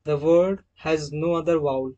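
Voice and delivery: at the end of a word read slowly and deliberately; slow dictated rule. Shown as a demonstration. Teacher reading a rule slowly for students to note down. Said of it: "rule number second".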